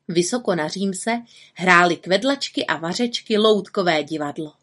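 plain reading voice

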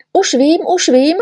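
high, loud eager shout; Neználek shouting he's got it; excited cry. A narrator's reading. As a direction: burst out with discovery. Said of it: "vykřikl Neználek".

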